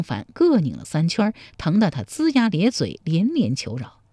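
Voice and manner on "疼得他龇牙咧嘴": plain reading voice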